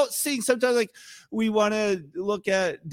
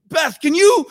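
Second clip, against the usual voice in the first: higher and louder, calling out to Beth; flustered call; 9 semitones higher, 9 dB louder than usual. Retold or imitated. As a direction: call Beth for help, overwhelmed. Flustered call to his wife.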